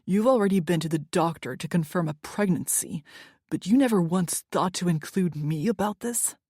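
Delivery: angry confrontation. Acted. Angry boyfriend confronting her.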